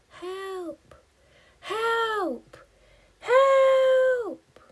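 help cries building to a high loud scream; growing cries for help. Acted. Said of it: "the cries for help grow louder and louder".

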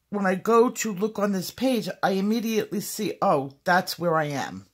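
ordinary talk, nothing marked

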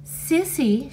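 high-pitched, sugary one-word answer; sweetly. A narrator's reading. Girl giving the man her name, sweetly. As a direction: lift it high and sweet with a smile. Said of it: "She smiled sweetly at Beecham".

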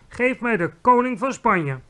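high, loud request; imperious demand. A narrator's reading. Queen speaks into the telephone, demanding imperiously. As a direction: demand it with authority.

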